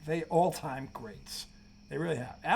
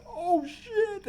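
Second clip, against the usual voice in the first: imitating shocked Magic. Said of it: "Magic was in the background going".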